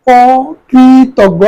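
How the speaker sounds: অকৃতজ্ঞ drawn out slowly as okritoggo; slow pronunciation demo. Shown as a demonstration. Teacher slowly spells out অকৃতজ্ঞ for the class, deliberate demo. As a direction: stretch অকৃতজ্ঞ slowly, first sound o. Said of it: "খেয়াল করো".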